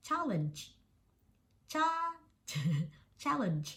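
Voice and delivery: teacher modelling challenge, high first syllable; word demo. Shown as a demonstration. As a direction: say "challenge" carefully, CHA high then falling. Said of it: "ก็คือคำว่า". (สาธิตคำ challenge เน้น cha ต้นคำ)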